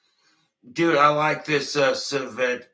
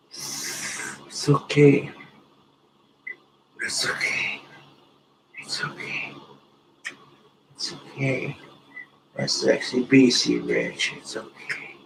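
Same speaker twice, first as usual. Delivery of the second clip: soothing whisper. Pretend. Soothing his guitar intimately.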